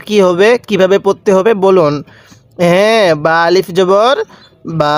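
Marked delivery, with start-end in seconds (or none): 3.2-5.0 long sustained aa on the last "বা"; drawn-out vowel. Shown as a demonstration. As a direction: spell it out, then hold the final বা long. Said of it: "এরকমভাবে টান দিতে হবে".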